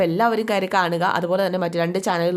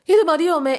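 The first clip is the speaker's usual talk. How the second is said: put-on higher, louder little girl's question; timid daughter; 9 semitones higher, 4 dB louder than usual. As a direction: ask it like an unsure young girl. Young daughter asks mother shyly. (പേടിയോടെ ചോദിക്കുന്ന മകൾ)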